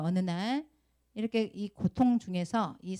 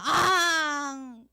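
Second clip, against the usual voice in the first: long, loud high mock bawl; drawn-out pretend howl of crying; imitated child's wail; 7 semitones higher, 7 dB louder, much slower than usual. Teacher shows Sua's reaction to the rod, long mock wail. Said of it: "이런단 말이에요".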